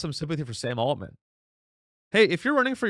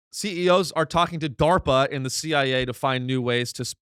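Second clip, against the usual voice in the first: ordinary talk, nothing marked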